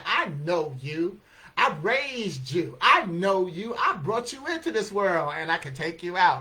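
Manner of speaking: loud, emphatic parental lecture; scolding parent. Retold or imitated. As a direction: lay down the law like an exasperated parent. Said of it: "they specialize in saying".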